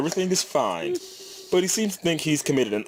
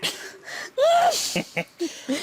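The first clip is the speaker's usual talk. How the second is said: mock panicked wail. Panicked passenger cry.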